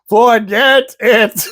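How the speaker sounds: half-speed exasperated repeat; retold exasperated repeat. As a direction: imitate grandpa spelling it out slowly for deaf ears. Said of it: "He goes".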